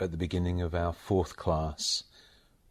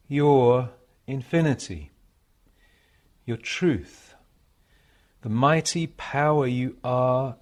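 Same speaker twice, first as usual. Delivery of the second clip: measured devotional recitation, higher and louder than chat; meditation reading; 7 semitones higher, 9 dB louder, slower than usual. Acted. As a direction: read it as a solemn meditation, pausing between phrases. Reads received passage as a meditation.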